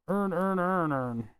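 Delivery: put-on Baltimore accent, every word merged into "earn". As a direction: slur it all into one sound in a Baltimore accent. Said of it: "that Baltimore, like, whatever it is, accent".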